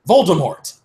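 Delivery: plain reading voice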